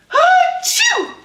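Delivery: high, loud exaggerated achoo; big sneeze. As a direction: let rip a huge sneeze. Gerald sneezes at the doctor's.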